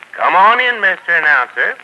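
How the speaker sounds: calling out. Acted. Host calling announcer in.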